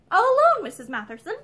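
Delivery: high, loud call across the garden. A narrator's reading. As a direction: call out to her playfully. Ramsden calling back to her.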